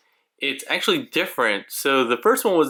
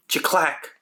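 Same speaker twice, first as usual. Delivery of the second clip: whispered sound effect; 4 semitones higher than usual. Acted.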